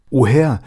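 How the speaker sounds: groaned plea; groaning. Acted. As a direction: groan out the words. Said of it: "ächzte der Großwesir".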